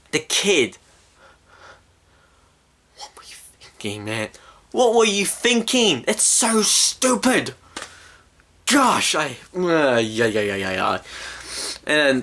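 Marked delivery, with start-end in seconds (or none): 3.0-4.3 hushed, voiceless reproach; whispered disbelief. Real, not acted. Rants at the film makers. 4.7-9.1 high-pitched indignant rant; exasperated outburst. Real, not acted. Rants at the film makers.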